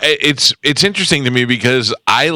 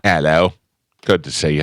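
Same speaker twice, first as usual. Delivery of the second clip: fake British accent; 5 semitones lower than usual. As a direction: lower mock-British "Hello". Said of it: "that was a fake accent".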